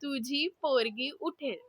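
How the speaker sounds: hushed, spaced-out words; soft challenge phrase. Shown as a demonstration. Sister repeats the phrase for the guesser, very soft. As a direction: mouth it out softly and carefully.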